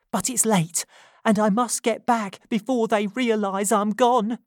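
urgent Nancy. A narrator's reading. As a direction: confess it as if your life depends on it. Nancy nervous to leave.

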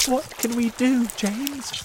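high, pleading question; anxious appeal. A narrator's reading. Ladybird turning to James for help, anxious appeal.

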